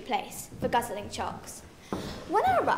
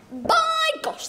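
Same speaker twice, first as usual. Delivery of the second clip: shocked exclamation. Acted. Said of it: "She cried".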